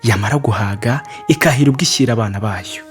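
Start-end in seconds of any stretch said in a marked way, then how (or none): none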